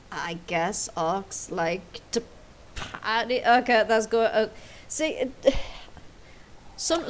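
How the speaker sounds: normal talking voice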